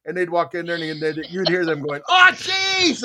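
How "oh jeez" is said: high, loud mock yell; disgusted outcry. Technicians hit by the office stink, disgusted shout. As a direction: yelp in horror at a stench.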